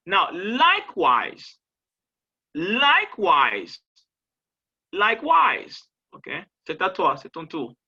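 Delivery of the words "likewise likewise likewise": LIKEWISE loud, both syllables punched apart; stressed teaching model. Shown as a demonstration. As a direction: model LIKEWISE emphatically for repetition. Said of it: "il y a des accents toniques". (« likewise » martelé fort, en deux syllabes bien détachées)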